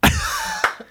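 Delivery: high-pitched pretend weeping; mock tearful. Host pretending to be moved by applause, mock tearful. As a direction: put on gushing, overwhelmed waterworks. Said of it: "turn on the waterworks".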